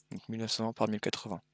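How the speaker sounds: muttered aside. Real, not acted. Reading settings aloud to himself.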